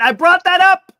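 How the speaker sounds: high, loud burst of vindication; excited interjection. Real, not acted. Host breaks off reading to claim the point, excited.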